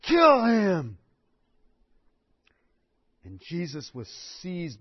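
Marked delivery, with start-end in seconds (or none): 0.0-1.0 mock angry crowd cry; a hint of a furious mob. Preacher voicing the angry mob, mild mock shout. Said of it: "the excitement was turned into rage".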